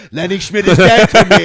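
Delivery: loud hammed-up grudge; mock furious shout. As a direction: bellow it like a mock vendetta. Mock vow of revenge.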